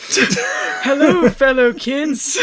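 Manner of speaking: loud, high, slowing British greeting; British accent. Acted. Host imagines the brother popping up, mock British accent. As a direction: put on a hammed-up British voice. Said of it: "I gave him a British accent".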